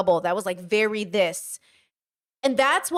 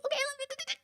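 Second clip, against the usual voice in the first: 1 squeaky goodbye; 11 dB quieter, much faster than usual. Retold or imitated. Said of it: "I talk to them".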